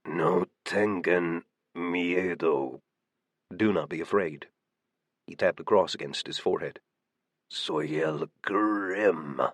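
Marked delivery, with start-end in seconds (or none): 0.0-2.8 slow, low rasping growl; raspy voice. A narrator's reading. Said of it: "he spoke in his horribly raspy voice". 7.5-9.4 slow, low rasping growl; raspy voice. A narrator's reading. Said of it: "he spoke in his horribly raspy voice".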